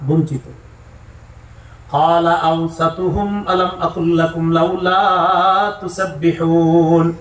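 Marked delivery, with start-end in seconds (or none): 1.9-7.1 drawn-out Quranic chanting; slow recitation. Acted. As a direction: intone it slowly like recitation. Recitation of verse.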